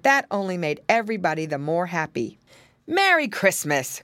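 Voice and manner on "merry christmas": higher, hearty cried greeting; lifted, cheery character cry; Uncle Wiggily. A narrator's reading. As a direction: clear rabbit uncle calling out. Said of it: "cried Uncle Wiggily".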